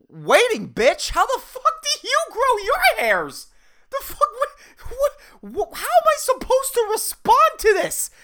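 high, incredulous comic rant; exasperated outburst. Acted. Barista silently answers customer's mustache question, exasperated. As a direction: squeal in outraged disbelief, stretching the words.

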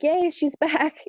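drawn-out, high-pitched welcome cheer; gleeful cheer. Retold or imitated. Spirits welcoming her back home, gleeful cheer. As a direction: voice delighted spirits, high and slow.

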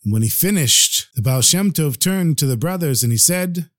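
ordinary delivery

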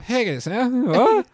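groggy, high and confused; just woken, befuddled; dazed dragon. Retold or imitated. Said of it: "This was the noise when they wake up".

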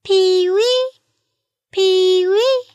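very high call, lifted at the end; kiwi answering call. A narrator's reading. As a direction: call back "Pee-wee" high, rising on the last syllable. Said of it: "Tiny called back".

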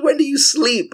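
very high-pitched incredulous imitation; mock-astonished high voice; retold amazement. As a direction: mimic the baffled friend up high. Mimics friend's amazement at energy.

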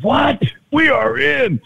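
high-pitched, thrilled disbelief; excited reaction. Retold or imitated. Player hears agent's million-dollar offer, excited. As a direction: jump up in pitch, jubilant at the news.

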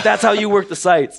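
high-pitched imitated Russian accent; hammed-up Russian-accented instructor; Sergei Luzov. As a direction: put on an emphatic Russian coach's accent. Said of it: "It sounds Russian".

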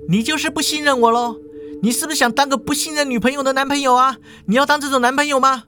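put-on high, rapid-fire girlfriend; fast, high-pitched argument; quarrelling woman. A narrator's reading. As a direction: fire indignant questions at a boyfriend. Acted lovers' quarrel.

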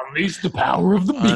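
hyped, high, loud slogan; energy drink ad. Pretend. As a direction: sell it like a monster truck ad.

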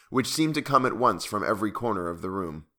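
ordinary delivery

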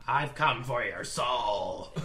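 slow, low ghostly voice luring a victim; haunting whisper-voice. Acted. Spirit taunting sleepy Philippe, slow low menace. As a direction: drag the words out like a hungry spirit.

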